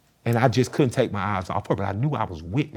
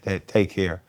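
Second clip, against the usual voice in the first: usual speaking voice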